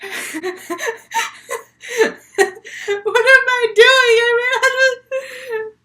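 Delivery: high, loud burst through laughter; helpless, high-pitched giggly outburst; cracking up. Real, not acted. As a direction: lose it laughing mid-sentence. Storyteller breaks down laughing.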